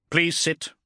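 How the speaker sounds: a touch of stern command. A narrator's reading. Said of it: "the Ultramarine captain said sternly".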